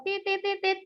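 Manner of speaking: high beeping honks tit tit tit; imitating a motorbike horn; horn honk imitation. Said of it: "dia klekson-klekson".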